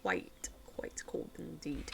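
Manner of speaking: hushed, slowed whisper; whispered aside. Real, not acted. Streamer musing about her cold feet, slow whisper. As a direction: murmur it like a secret.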